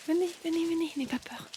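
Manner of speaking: high and soft, reassuring; soft coaxing. Real, not acted. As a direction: coax a shy animal gently. Coaxing shy sheep. (appel doux et rassurant)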